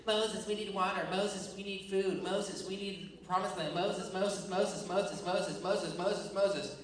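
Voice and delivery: mock pestering sing-song nag; complaining. Israelites badgering Moses for water and food, whiny pestering. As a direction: nag on and on, repeating the name relentlessly. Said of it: "all these people are complaining".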